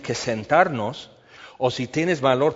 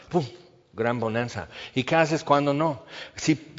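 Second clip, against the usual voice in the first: ordinary talk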